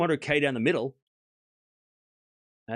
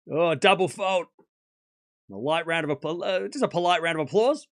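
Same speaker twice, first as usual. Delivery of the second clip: normal talking voice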